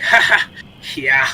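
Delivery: dry, mock-amused reply; sarcastic. Player voicing a reply to weary fellow travellers, sarcastic. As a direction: give a token sarcastic laugh, then agree. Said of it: "one of those sarcastic tones".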